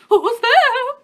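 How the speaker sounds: put-on a wolf faking an old woman's voice; granny voice. The wolf in bed calls to the girl, as granny. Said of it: "in his best granny voice".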